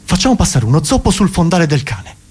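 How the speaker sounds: a touch of triumphant brainwave; director with an idea. Acted. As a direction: pitch it like a breakthrough. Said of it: "uno dice all'altro".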